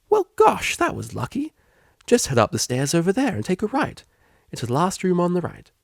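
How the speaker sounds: bright, high, eager helper voice; eager receptionist. Acted. Receptionist gushing to Octavia, high and eager. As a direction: gush like an overeager receptionist.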